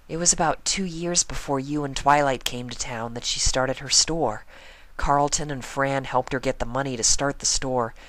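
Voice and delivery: a shy pony recounting sad news. Acted. Fluttershy soft telling.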